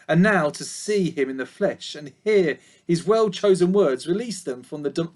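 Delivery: ordinary delivery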